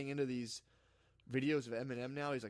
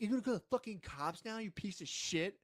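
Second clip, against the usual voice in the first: higher, retold angry challenge; lightly acted outrage, pitched up; mock indignation; 6 semitones higher than usual. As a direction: imitate a fed-up lady having it out. Imitating angry lady in quarrel.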